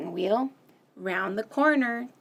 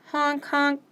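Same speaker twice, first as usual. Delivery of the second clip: ordinary talk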